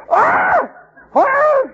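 high startled cries of horror; shocked outcry. Retold or imitated. Parents crying out in mock horror at the news.

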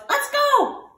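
high eager call; rally everyone, pitched high; excited shout. A narrator's reading. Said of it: "and he shouts".

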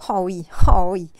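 a sudden loud mocking cry; mocking outburst. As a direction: burst out loud, jeering. Jeering at the dead husband. (éclat moqueur et sonore)

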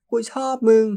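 loud exasperated love confession; open shouted confession. Acted. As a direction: shout the confession without holding back. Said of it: "เสียงนี้ออกมาอย่างไม่ปกปิด".

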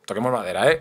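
usual speaking voice

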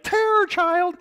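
very high, loud mock shriek; imitating a screaming child. Lecturer imitates a colicky baby's sudden screaming, loud mock shriek. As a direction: shriek it like a colicky baby mid-fit. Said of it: "The child will scream and it'll be like".

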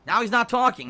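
loud, high mock outrage; loud, high jeering. Mocking the silent streamer.

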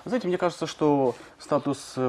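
ordinary talk, nothing marked

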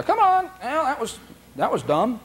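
high, light "never mind" tone; breezy dismissal. Shown as a demonstration. Handler steering the dog away, breezy and high. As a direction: brush it off cheerfully.

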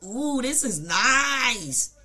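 put-on slow, drawn-out girly coo; slow mock admiring coo; mock impressed girl. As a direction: coo over the car like an impressed date. Imitating girl admiring the car.

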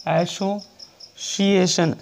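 very slow, each syllable stretched; teacher dictating the word slowly; drawn-out dictation. Shown as a demonstration. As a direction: spell it out slowly for the class. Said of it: "इसको सुना".

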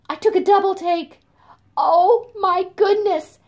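high, loud astonished exclaim; surprised. Retold or imitated. Storyteller to children, amazed at finding the moth. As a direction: gasp out the discovery in wonder.

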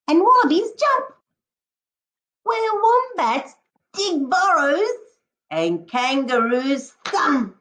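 lively, sing-song picture-book read-aloud; expressive storybook voice with a howl at the end; reading to children. Acted. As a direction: paint each jungle animal for the class. Teacher reads picture book aloud.

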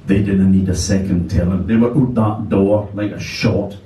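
ordinary delivery

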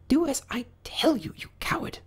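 high, soft hissed rabbit whisper; whispered order. A narrator's reading. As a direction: whisper the scolding to the servant. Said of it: "only hear whispers now and then".

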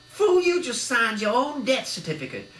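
high, loud menacing thug yell; gangster shout. A narrator's reading. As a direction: sentence him to death. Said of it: "shouted Arnie".

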